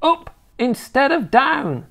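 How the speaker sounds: high, slow scolding grandma; snapped retort. A narrator's reading. Grandma answering her grandson's protest, snappish. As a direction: snap it back in a high old woman's voice. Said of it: "she snapped".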